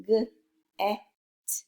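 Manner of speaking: G, e, t as separate letter sounds; blending sounds. Shown as a demonstration. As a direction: sound out "G, e, t" with gaps between. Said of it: "as I blend sounds".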